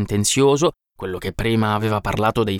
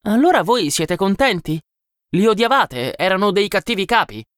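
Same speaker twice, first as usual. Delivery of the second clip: lightly voiced, higher-pitched probing questions; a hint of an inquisitive outsider, pitched up; questioning visitor; 10 semitones higher than usual. A narrator's reading. Visitor quizzes workers about their chiefs, lightly voiced questioning.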